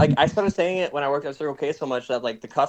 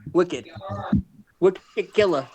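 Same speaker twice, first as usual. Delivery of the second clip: lightly copied Boston accent, higher pitch; quick Boston imitation; 5 semitones higher, much slower than usual. Shown as a demonstration. Friend trying out the slang, imitated Boston accent. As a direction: try "Wicked killer" in a quick two-beat Boston accent. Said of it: "You gotta say it kinda quick".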